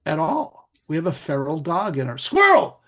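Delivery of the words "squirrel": a sudden high yelp, the dog mind spotting a squirrel; sudden squirrel outburst. Pretend. Guest mimics the feral dog mind, sudden excited squeal. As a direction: blurt it out loud like a distracted dog.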